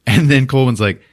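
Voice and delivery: normal talking voice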